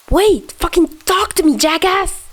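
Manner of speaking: high, strained furious yell; hurt angry shout. Acted. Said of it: "Chuya shouted, upset and hurt".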